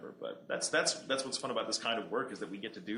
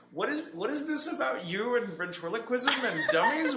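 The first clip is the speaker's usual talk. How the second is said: amazed; 12 semitones higher, 5 dB louder than usual. Real, not acted. Amazed question, high, laughing.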